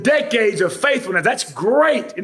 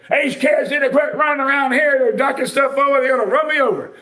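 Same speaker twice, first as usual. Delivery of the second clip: higher, complaining churchgoer; mock grumbling old member; 5 semitones higher than usual. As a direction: whine about the kids running around.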